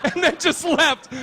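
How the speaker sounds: high, loud sarcastic retort; girl mocks the accusation in a high voice. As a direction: scoff at the accusation. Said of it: "you hear one girl just go".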